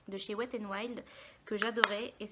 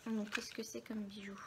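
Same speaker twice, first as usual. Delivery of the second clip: whispering; 4 dB quieter than usual. Real, not acted. Whispered question to self.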